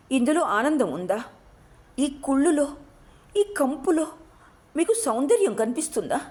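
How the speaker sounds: high, pained anguished outburst; distraught crying out. A narrator's reading. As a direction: cry out in despair, voice climbing. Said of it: "అంటూ అరుస్తూ".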